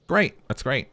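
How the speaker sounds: sudden high, loud "Great!"; excited exclamation. Real, not acted. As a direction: burst out with delight. Reacting to case name.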